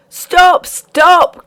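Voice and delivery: two high, loud cries of stop; the miller crying out to stop Alice; anguished cry. A narrator's reading. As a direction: call out to halt them, desperate. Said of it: "cried the miller".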